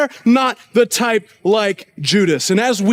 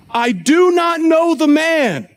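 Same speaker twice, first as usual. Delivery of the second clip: slow, higher-pitched, emphatic oath; sworn denial; 5 semitones higher, slower than usual. Acted. Peter swears to the bystanders, an emphatic final denial. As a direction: swear it, drawing out every word. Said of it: "invoke a curse on himself and to swear".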